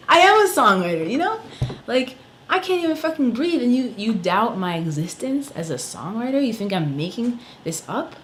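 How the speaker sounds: real anger at being doubted; indignant outrage. Real, not acted. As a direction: push back, furious and disbelieving. Outraged rant about counselor.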